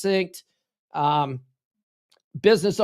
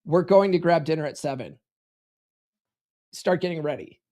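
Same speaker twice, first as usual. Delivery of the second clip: command tonality; 2 semitones lower than usual. Shown as a demonstration. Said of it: "You're using command tonality".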